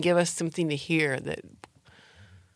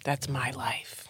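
slow, soft EVP whisper; imitated ghost recording, whispered; ghostly whisper; 5 semitones lower, 9 dB quieter, slower than usual. Guessing what ghost whispered.

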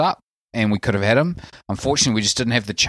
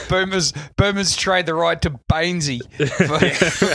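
joking through laughter; laughing; 6 semitones higher than usual. Real, not acted. Joking to friends about a mock trade, laughing. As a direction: crack up while saying it.

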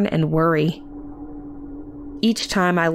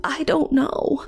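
higher, helpless little reply; half-mumbled, pitched up; 9 semitones higher than usual. A narrator's reading. Worker answers the aunt, mumbling helplessly. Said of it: "I mumbled out".

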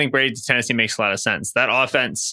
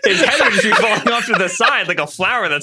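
louder, higher, through laughter; laughing; 5 semitones higher, 4 dB louder than usual. Real, not acted. Joking about a long neck, laughing. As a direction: laugh while building the absurd image.

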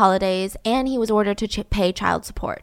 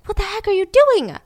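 retold screaming; 10 semitones higher than usual. Friend waking to someone watching them, mock screaming. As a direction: imitate a startled, outraged cry. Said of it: "She's screaming".

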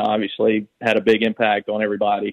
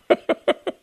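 high burst of laughter. Real, not acted. Teller laughs at own joke.

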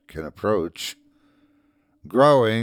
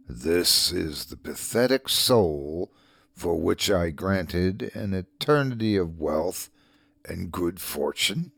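low, softer demon voice from a deep well; dark lord; 4 semitones lower, 4 dB quieter than usual. A narrator's reading. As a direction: speak as a shadow rising from the depths. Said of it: "as if from the bottom of a deep well".

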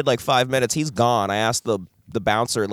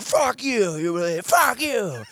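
higher-pitched mock angry outburst; imitating drunk dad; 9 semitones higher, slower than usual. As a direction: spit it out like a huffy drunk father. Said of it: "Oh, you, like".

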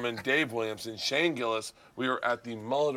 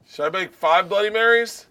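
calling out; 8 semitones higher, 9 dB louder than usual. Real, not acted. Calling out again, louder.

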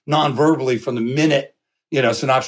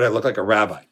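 normal talking voice

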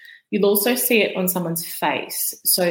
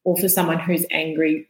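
ordinary talk, nothing marked

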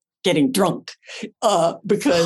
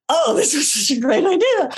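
giddy laugh; 9 semitones higher than usual. Retold or imitated. Drunk on set, laughing.

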